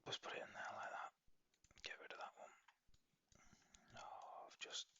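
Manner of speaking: whispered to himself; whispering. Real, not acted. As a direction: mutter it under your breath. Muttering to himself while editing.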